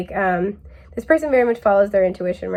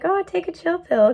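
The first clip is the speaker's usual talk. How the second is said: mock laid-back voice, higher; breezy take-it-easy brush-off; chill hippie; 9 semitones higher than usual. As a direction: hippie shrugging off someone's worry, pitched higher. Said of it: "they're that, like".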